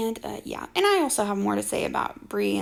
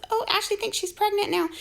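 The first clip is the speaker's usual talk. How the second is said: mocking quote; 9 semitones higher than usual.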